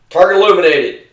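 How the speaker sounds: loud, high range call; retold fire command. Range control announcing to the gunners, loud call. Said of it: "then they'd say".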